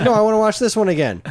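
put-on high, loud, fast kid voice; high whiny kid. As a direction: whine it fast like a stubborn kid. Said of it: "I'm like".

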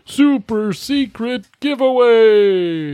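very high, slow, drawn-out announcer voice; dramatic announcer. Acted. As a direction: stretch it out like a grand reveal. Hyping the Patreon giveaway.

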